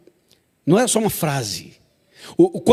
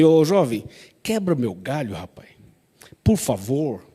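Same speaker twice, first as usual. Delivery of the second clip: mock pleading, softer and lower; pleading softly; 4 semitones lower, 4 dB quieter than usual. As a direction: beg the dead man meekly, as Jesus did not. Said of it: "Não fo-, foi assim não". (súplica fingida, mais baixa e suave)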